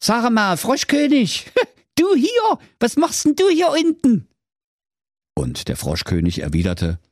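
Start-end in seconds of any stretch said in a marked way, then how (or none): 0.0-4.3 very high, squeaky-pitched astonished hero; surprised Schwerthelm. A narrator's reading. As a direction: exclaim it high, baffled to find the frog king. Voices the hero of the tale. (verdutzter Held, sehr hohe Stimme)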